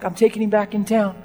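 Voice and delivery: ordinary talk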